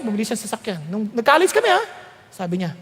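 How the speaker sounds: ordinary delivery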